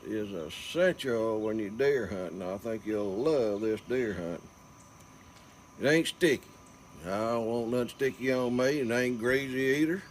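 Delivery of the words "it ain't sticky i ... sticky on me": normal talking voice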